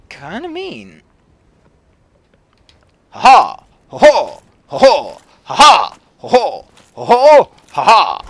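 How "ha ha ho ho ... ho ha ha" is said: loud theatrical ha ha ho ho; hammy villain laugh. Acted. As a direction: belt out a mock evil laugh.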